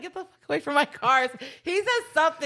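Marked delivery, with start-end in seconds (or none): 0.0-1.3 mock angry yell. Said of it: "he's like".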